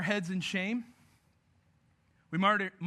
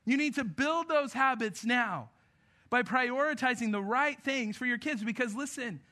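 raised pulpit voice; emphatic preaching; 7 semitones higher than usual. Real, not acted. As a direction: preach it hard, stretching the key words. Preaches at full force.

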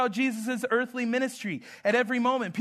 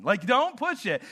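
ordinary talk, nothing marked

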